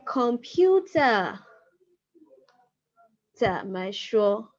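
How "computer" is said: "Computer" said slowly, falling; slow model word. Shown as a demonstration. Teacher slowly modelling "Computer" for learners. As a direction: draw out "Computer" for the students. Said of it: "You can say".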